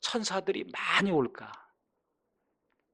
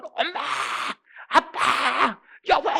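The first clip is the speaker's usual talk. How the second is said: loud, higher cries for family; calling out in distress; 8 semitones higher, 6 dB louder, much slower than usual. Retold or imitated. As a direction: shout for mom and dad in trouble. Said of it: "막 부르지 않습니까?". (다급하게 부르는 외침)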